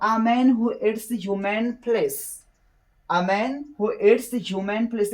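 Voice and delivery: very slow, word by word, for students to follow; slow dictation. Shown as a demonstration. Teacher reading the sentence slowly to online students, word by word. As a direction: read it out like dictation to a class. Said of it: "teacher repeats sentence slowly".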